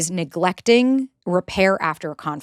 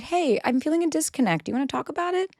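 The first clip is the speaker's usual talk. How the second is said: softer, much higher tentative voice; gentle check-in; 7 semitones higher, 6 dB quieter than usual. Shown as a demonstration. Partner noticing distance, gently asking to talk. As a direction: invite a partner to talk without blame. Models raising a disconnect gently.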